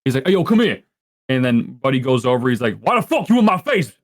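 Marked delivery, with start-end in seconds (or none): 0.3-0.8 higher-pitched mock call; retold beckoning call; calling over. Retold man calling someone over. 2.8-3.9 high, indignant challenge; hammed-up angry outburst; mock confrontation.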